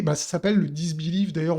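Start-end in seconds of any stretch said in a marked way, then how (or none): none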